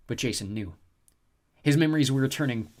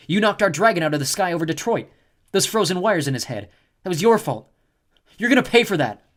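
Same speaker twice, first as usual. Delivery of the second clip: louder, higher retort; angry Leo; 8 semitones higher, 9 dB louder than usual. A narrator's reading. Leo blaming the snow goddess, loud angry retort. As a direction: snap back in anger.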